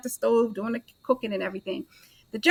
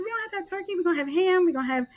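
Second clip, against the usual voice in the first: exasperated; 7 semitones higher, faster than usual. Retold or imitated. Arguing with husband about turkey.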